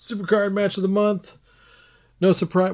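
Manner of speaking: high, projected award announcement; mock announcer. Announces the monthly award category.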